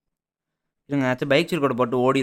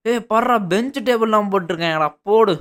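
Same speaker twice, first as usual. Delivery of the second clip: loud, high, thrilled exclaiming; raised voice, buzzing with excitement; excited discovery; 6 semitones higher, 4 dB louder than usual. Real, not acted. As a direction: crow over an amazing find. Excited discovery inside the school.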